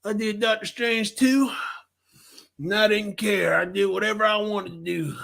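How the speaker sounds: lightly voiced cocky director; a hint of a boastful filmmaker; imagined Sam Raimi. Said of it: "not saying that Sam Raimi sounds like that".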